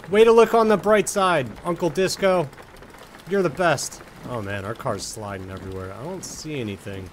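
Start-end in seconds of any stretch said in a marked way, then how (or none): 0.1-4.0 excited. Real, not acted. Cheering the game uncle.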